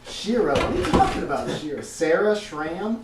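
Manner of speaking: high, disbelieving questions; baffled, pitch jumping up; incredulous. Real, not acted. GM incredulous at wrong name.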